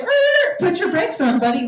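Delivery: high, loud sassy retort; snapping back at the rude dancer, high and loud; mock snap back. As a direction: put him in his place.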